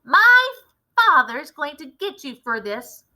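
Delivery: high shriek breaking into a mock threat. A narrator's reading. Bully shrieking threat at the narrator. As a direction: shriek it, then huff off. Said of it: "he leapt up shrieking".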